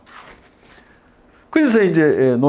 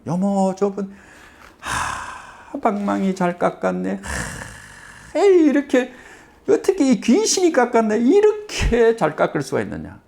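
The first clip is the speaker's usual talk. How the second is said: amazed praise; 7 semitones higher than usual. Retold or imitated. Wife marvels at the bat.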